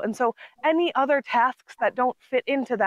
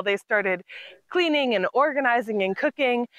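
normal talking voice

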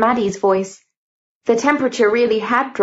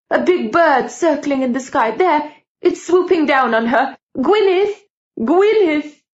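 terrified screaming; 7 semitones higher than usual. Acted. Aunt in a vision seeing a bird dive at the girl, terrified scream. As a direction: panic climbing to a scream. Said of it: "She began to scream".

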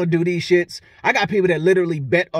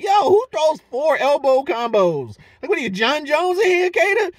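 incredulous; 9 semitones higher, 3 dB louder than usual. Real, not acted. Incredulous at elbow combos.

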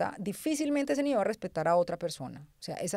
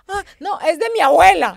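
put-on higher, louder kid's excuse; lightly voiced little girl shrugging it off; a hint of a bratty child; mock child voice; 8 semitones higher, 11 dB louder than usual. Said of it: "y yo".

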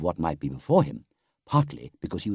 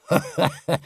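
higher-pitched Badger chuckle; good-humoured laugh; 8 semitones higher than usual. A narrator's reading. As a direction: chuckle heartily and kindly. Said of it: "The Badger laughed good-humouredly".

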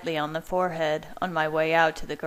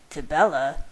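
mildly incredulous echo; puzzled, disbelieving question; 4 dB louder than usual. A narrator's reading. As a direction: repeat it in disbelief, not catching on. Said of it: "said Jacob incredulously".

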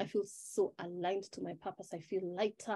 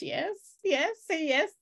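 eager coaxing; 9 semitones higher, 7 dB louder, slower than usual. Real, not acted. Coaxing viewers to agree.

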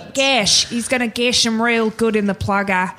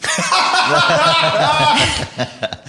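loud laugh; 7 semitones higher, 3 dB louder than usual. Real, not acted. Laughing at own joke.

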